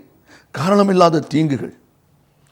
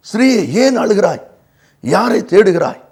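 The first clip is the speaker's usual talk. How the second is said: higher-pitched, fully voiced preaching question; ringing repeat of a quoted question; raised, emphatic question; 5 semitones higher than usual. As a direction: put the question out clearly, pitched up. Repeats Jesus's question to Mary.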